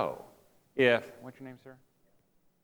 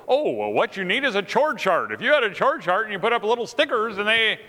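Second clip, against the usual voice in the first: over-helpful husband; 7 semitones higher, 5 dB louder than usual. Retold or imitated. Over-helpful husband offering a fix.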